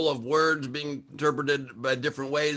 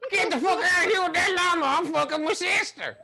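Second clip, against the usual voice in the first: very high, hammed-up country twang; twangy country accent. Small-town local chasing off a llama, country accent. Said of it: "if you got that twang".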